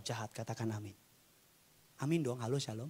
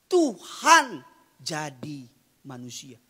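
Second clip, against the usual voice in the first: "Tuhan jadi manusia" slowly, word by word; weighty, slow "Tuhan jadi manusia"; emphatic correction; 11 dB louder, much slower than usual. Real, not acted. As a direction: hit "Tuhan" loud, then spell out "jadi manusia" slowly. Corrects the reversed statement.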